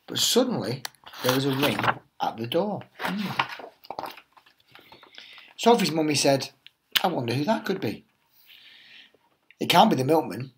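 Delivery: lively storybook read-aloud voice; animated teacher reading The Tiger That Came to Tea; reading to children. Acted. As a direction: tell it like a bedtime picture book for little ones. Teacher reads picture book aloud.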